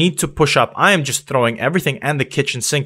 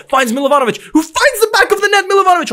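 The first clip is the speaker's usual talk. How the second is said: very high, loud screaming commentary; ecstatic goal call; 4 dB louder, faster than usual. Real, not acted. Goal then offside, commentating.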